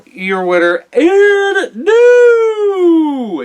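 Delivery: drawn-out booming winner announcement; ring announcer. Acted. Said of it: "I love hearing it whenever you guys".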